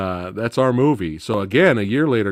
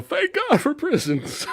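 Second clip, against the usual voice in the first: mock squeal. Pryor going around the prison, high mock squeal. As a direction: squeal it out through laughter.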